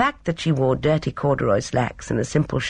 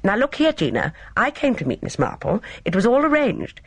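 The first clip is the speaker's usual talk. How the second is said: higher-pitched, mildly indignant objection; protesting; 7 semitones higher than usual. A narrator's reading. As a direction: object as if your job is being taken. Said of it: "He protested".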